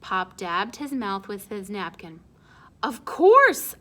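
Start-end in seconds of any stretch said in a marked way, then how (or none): 2.8-3.8 loud, high proud declaration; proud exclamation. Acted. Pop proudly telling the family, loud and high. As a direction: boom it out proudly.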